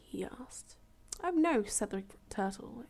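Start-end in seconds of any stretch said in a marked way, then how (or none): 1.2-1.6 high, loud quick denial; eager reassurance. Acted. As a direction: brush the worry away. Turtle reassures rabbit.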